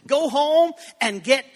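rally the church to go home; food drive call. Retold or imitated. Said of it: "people say".

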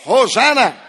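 loud called-out hosanna. Real, not acted. Preacher leading the church in a loud hosanna. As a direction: lead the church in a loud cry. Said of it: "let's do a Hosanna".

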